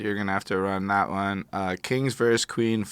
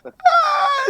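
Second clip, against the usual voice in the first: laughing yelp; 5 dB louder than usual. Real, not acted. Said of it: "sometimes shit sounds racist".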